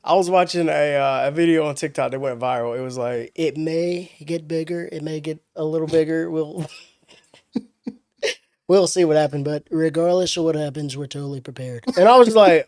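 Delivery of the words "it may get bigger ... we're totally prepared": Donald Trump impression. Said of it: "The Trump, okay".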